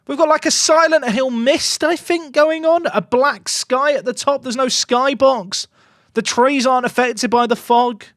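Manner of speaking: high-pitched mocking rant about the graphics. Real, not acted. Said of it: "Let me rant about these trees".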